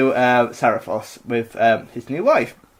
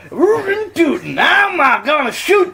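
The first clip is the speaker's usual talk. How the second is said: high hammed-up Texan cowboy yell; cowboy accent; 12 semitones higher, 4 dB louder than usual. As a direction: shout it like a cartoon Texas cowboy. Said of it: "what's cow".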